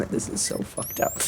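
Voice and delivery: whispered aside, barely voiced; under-breath whisper. Real, not acted. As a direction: hiss it to yourself in disgust. Muttering in disgust at game.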